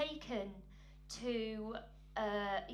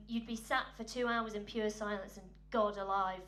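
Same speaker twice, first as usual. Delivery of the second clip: ordinary delivery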